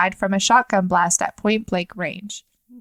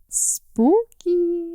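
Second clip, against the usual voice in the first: spooky; 8 semitones higher than usual. Real, not acted. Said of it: "comments on eerie story".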